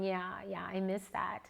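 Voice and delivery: soft, murmured yes; quiet nodding agreement; wistful agreement. Retold or imitated. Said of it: "nodding your head going".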